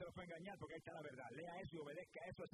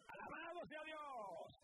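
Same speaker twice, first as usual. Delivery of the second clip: drawn-out praise shout; 10 semitones higher, much slower than usual. Acted. Praise after healing.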